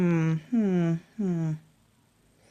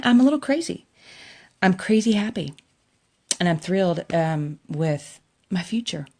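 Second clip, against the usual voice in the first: usual speaking voice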